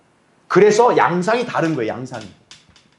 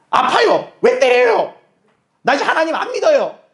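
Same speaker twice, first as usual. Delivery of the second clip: very high, loud whining protest; whiny believer; 11 semitones higher, 4 dB louder than usual. Retold or imitated. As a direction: sulk like a spoiled child. 미숙한 그리스도인 흉내. (떼쓰는 아이처럼 아주 높고 큰 투정)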